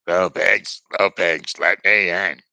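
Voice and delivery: loud, booming wolf demanding entry; big bad wolf. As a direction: bellow it through the door. Wolf knocks at second house.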